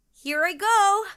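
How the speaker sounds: very high, loud here I go; excited. A narrator's reading. Katie starting to ride, excited and loud. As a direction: burst out thrilled.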